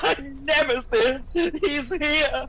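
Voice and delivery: dramatic alarm. Acted. Kingsley hears his nemesis named, in a high dramatic cry. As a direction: exclaim like a melodrama hero.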